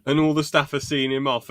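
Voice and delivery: ordinary delivery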